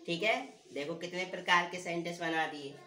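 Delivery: ordinary talk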